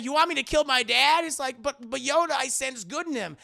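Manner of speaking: high, loud whiny protest; mock pleading Luke. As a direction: whine at the master. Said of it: "And he's like".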